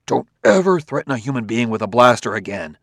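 ordinary delivery

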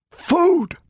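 very high-pitched cry of discovery; astonished gasp. A narrator's reading. Donovan suddenly spots the shelves of cans, astonished gasp. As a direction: gasp the word high, stunned by the sight. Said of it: "he gasped".

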